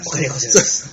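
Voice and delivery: a touch of the comic butler; polite butler line, lightly imitated; Norihei impression. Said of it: "似てますよね". (三木のり平の物真似)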